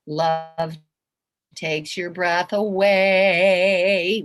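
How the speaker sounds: loud, very slow, stretching AWAY; drawn-out. Shown as a demonstration. Repeating it to show how big love is, drawn-out. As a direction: hold each word long, swelling on "away".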